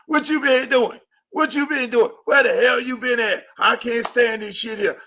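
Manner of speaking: high, loud naggy accusing tirade; mock-scold him like a jealous girlfriend; nagging mom yelling. Host voicing a nagging woman, mock angry yelling. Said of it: "mommy never is like".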